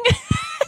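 shrill excited squeal of laughter; high squealing laugh. Real, not acted. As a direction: let out a giddy squeal. Giddy shriek over astrology.

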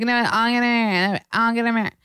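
high, hammed-up insistence; self-mocking high excited repeat; insistent showing off. Retelling her own insistent showing off.

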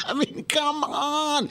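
high, slow disbelief; incredulous. Real, not acted. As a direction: drag it out in disbelief. Incredulous after laughing.

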